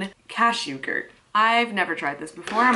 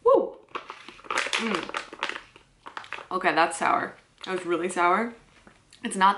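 food in mouth; chewing while talking. Real, not acted. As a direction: sour puckered face. Seen 1: Bites at -7 and -4; still chewing, grimacing during words.

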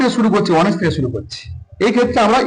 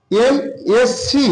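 MSc as three separate letters, pauses between; 5 semitones higher, much slower than usual. Shown as a demonstration. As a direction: spell out MSc slowly, letter by letter. Spells out the abbreviation's letters. (MSc প্রতিটি অক্ষর আলাদা করে, থেমে থেমে)

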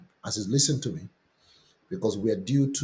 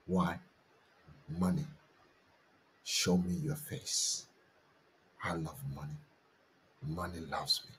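softer, intimate sermon voice; hushed preaching; 6 dB quieter than usual. Acted. Pastor softly shares testimony, intimate and hushed. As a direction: draw the church in close and quiet.

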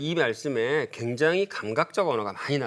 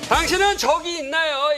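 high, loud host question; dramatic announcement; 13 semitones higher, 6 dB louder than usual. Acted. (극적인 진행자 말투)

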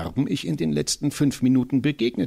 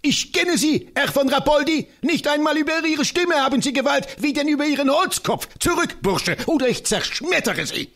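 high, loud, triumphant challenge; a tiny scholar crowing defiance, pitched high; defiant little professor; 14 semitones higher, 3 dB louder than usual. Acted. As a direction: scorn the enemy at the gate. Professor defies enemy at gate.

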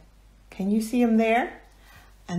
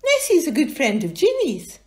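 higher, louder insistent character; higher indignant little voice; lightly voiced Hamish; 8 semitones higher, 8 dB louder than usual. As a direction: stand up for a friend.

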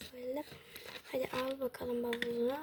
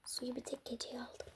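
whisper; 9 dB quieter than usual. Acted. Quiet aside while packing.

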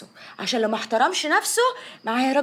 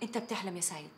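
cold low dismissal; 5 semitones lower, 16 dB quieter than usual. Acted.

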